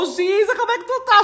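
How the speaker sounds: high, lightly voiced caller with a laugh; imitating the nanny. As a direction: mimic her sweet greeting. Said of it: "A Babá hoje me ligou". (imitando a babá com voz aguda)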